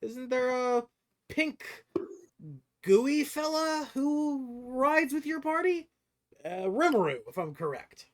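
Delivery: high, loud, drawn-out sizing up of the party; inquisitive stranger. Acted. Stranger at the table checks the party roster, inquisitive. Game master plays the stranger.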